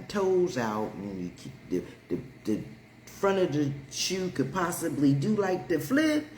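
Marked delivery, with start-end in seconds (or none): 3.1-6.3 high excited acting out of the shoe flipping; lively storytelling pitch jump; animated demonstration. Real, not acted. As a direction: paint the flip with your voice.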